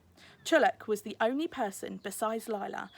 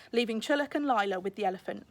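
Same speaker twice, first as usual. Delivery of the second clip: ordinary delivery, nothing marked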